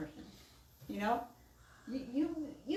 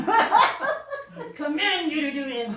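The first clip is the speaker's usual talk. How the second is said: slow, louder, hammed-up command; mock dictator order; 5 semitones higher, 8 dB louder, much slower than usual. Mocking a harsh order, slow and loud. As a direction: play a tyrant giving orders, laughing. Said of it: "Like a Nazi order".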